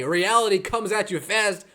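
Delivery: ordinary talk, nothing marked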